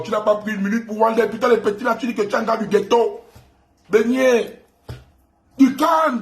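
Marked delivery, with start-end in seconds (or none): 3.9-6.2 du calme lancé haut et fort; nargue-le d'une voix haute; moquerie aiguë. Acted. Mocking chant at rival. (taquinerie aiguë et forte)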